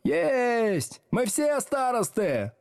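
high gleeful crowd cry; jubilant yelling. A narrator's reading. Back rows tease teacher about monitors, jubilant yelling. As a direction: shout it high with mocking triumph. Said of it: "ликующе завопила галёрка".

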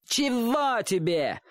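high, dragged-out taunting echo; slow, jeering imitation way up high; mocking mimicry. A narrator's reading. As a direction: parrot it back in a whiny boyish singsong. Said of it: "передразнил его мальчишка".

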